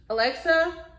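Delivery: calling Alexa. Real, not acted. Calling out to smart speaker.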